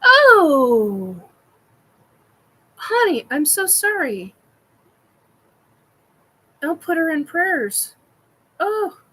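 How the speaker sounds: crying out oh, honey, high and pained; heartfelt, choked-up sympathy; real sympathetic dismay. Real, not acted. To a chat viewer with sad news, pained sympathy.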